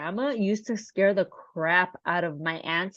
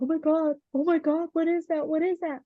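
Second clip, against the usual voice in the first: high, mock-scared little girls; retold fright; 8 semitones higher than usual. Scared girls hearing knocks at the window, mock frightened. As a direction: repeat it in a high, frightened kid voice.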